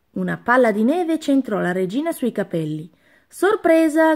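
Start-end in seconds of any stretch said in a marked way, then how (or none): 3.3-4.1 high, loud gleeful shout; shouted surprise. A narrator's reading. Anna springing from behind a tree, gleeful shout. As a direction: jump out and yell it. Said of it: "gridò Anna".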